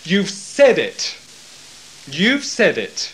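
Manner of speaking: slow, loud, emphatic "You've said it"; emphatic yes. Shown as a demonstration. Jesus answering Caiaphas emphatically, slow and loud. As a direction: stamp each "You've said it" firmly and slowly. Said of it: "The Greek is emphatically yes".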